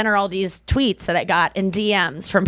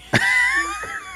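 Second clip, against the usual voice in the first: shrieking laugh. Real, not acted. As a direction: let out a wild shrieking laugh. Burst of laughter.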